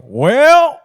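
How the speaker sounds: high, loud drawn-out Texan "Well"; mock Texan drawl. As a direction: stretch the "Well" like a small-town Lubbock kid. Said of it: "there from... Lubbock".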